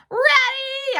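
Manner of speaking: put-on a child bursting with eagerness; yelled. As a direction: yell it with all your might. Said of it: "I yelled".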